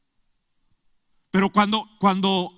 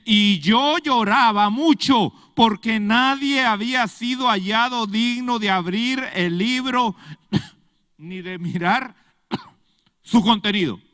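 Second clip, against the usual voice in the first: scripture reading; 4 semitones higher, 6 dB louder than usual. Acted. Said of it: "dice, dice".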